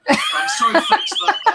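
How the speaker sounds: sudden high, loud laughing apology; high laughing outcry; laughing shout. Real, not acted. Laughing outburst mid-rant.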